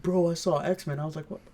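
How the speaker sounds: normal talking voice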